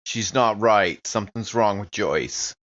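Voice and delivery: ordinary delivery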